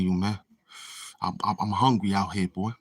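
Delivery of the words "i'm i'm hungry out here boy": usual speaking voice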